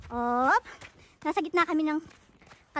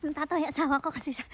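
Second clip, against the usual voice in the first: ordinary delivery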